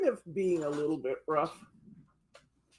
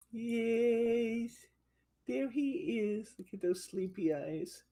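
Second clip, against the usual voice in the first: usual speaking voice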